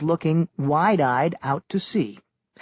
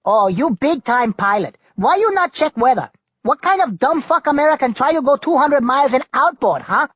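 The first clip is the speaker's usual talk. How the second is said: high, loud pidgin-English navigator berating the pilot; Kimmy the navigator; 9 semitones higher, 5 dB louder than usual. A narrator's reading. Kimmy scolding Tuck over the storm, loud and high.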